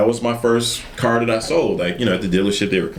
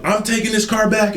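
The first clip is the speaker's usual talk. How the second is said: high mock angry threat; going off; 11 semitones higher than usual. Angry customer threatening to return the car, high mock anger. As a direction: snap it, pitched up. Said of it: "she's just going off on me".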